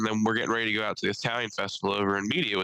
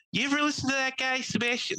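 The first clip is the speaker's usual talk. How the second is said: much higher, mock Italian fan voice. Host mocking Italians who rave about Sebastian, pitched high. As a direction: ham up an excited Italian fan. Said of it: "Italians in the past year being like".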